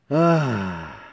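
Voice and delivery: drawn-out falling "ah"; long contented sigh. Retold or imitated. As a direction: let out a long, sinking sigh. Sighs, breathing in the moment.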